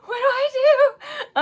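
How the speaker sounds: high frantic wail, laughing; panicked squeal. Retold or imitated. Panicking at the gondola controls, a high wail. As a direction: squeal in mock panic at the lever. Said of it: "I was like".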